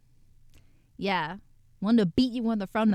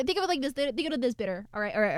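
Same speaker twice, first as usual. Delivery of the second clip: normal talking voice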